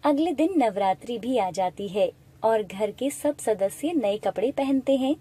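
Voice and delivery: ordinary delivery, nothing marked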